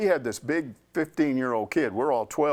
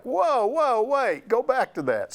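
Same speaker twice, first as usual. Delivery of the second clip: higher, eager call to stop; excited whoa; 10 semitones higher, 4 dB louder, slower than usual. Retold or imitated. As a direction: reporters jumping in to stop the coach, excited. Reporters interrupting excitedly.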